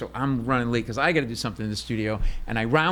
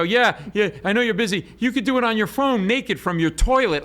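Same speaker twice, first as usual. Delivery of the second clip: nervous babble; 9 semitones higher, 4 dB louder than usual. Retold or imitated.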